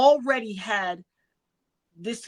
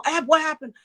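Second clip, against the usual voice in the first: mild mock yell, raised in pitch; screaming; 7 semitones higher, 4 dB louder than usual. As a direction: imitate an alarmed brother-in-law on the phone. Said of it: "And he was screaming".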